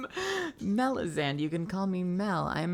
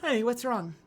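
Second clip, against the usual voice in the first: lightly voiced character; much slower than usual. Voicing game character dialogue.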